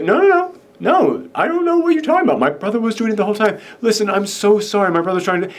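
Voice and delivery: high-pitched mock-innocent protest; playing innocent. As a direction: imitate someone overdoing the innocent act. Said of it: "trying to play off completely innocent".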